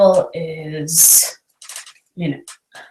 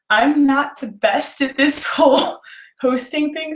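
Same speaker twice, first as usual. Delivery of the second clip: words spoken through a nervous giggle, higher pitch; nervous giggling; 8 semitones higher than usual. Real, not acted. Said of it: "so I'm sorry if I sound really giggly".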